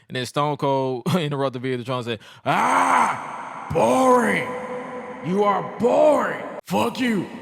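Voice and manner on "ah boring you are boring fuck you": high, loud, slow yelled insult; angry shout. Retold or imitated. Wrestler shouting down the talk show host, loud and angry. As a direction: bellow it at him like Stone Cold. Stone Cold yelling at Jericho.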